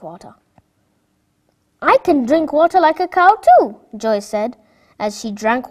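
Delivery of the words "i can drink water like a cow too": put-on child voice. Child voice, different timbre.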